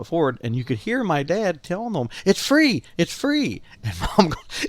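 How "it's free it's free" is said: eager calling. Retold or imitated. Dad urging the stranger.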